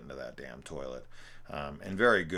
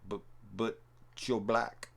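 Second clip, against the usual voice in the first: imitated stammer; 5 semitones higher than usual. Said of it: "And the guy's like".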